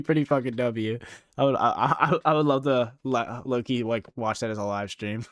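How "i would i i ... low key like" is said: ordinary talk, nothing marked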